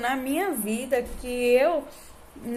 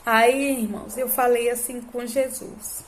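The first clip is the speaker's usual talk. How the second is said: usual speaking voice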